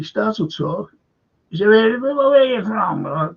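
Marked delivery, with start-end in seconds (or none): 1.7-3.1 higher, louder put-on questioning voice; imitated stranger. Stranger on an Italian beach asking his origin, loud imitation. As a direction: mimic a stranger's stuttered, raised-voice question.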